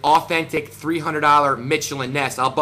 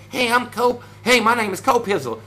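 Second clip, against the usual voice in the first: mocking impression; 5 semitones higher than usual. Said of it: "people like this fake, fraudulent".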